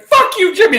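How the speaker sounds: hammed-up furious girlfriend; mock angry yell. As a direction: imitate her screeching it at Jimmy. Angry girl storming off.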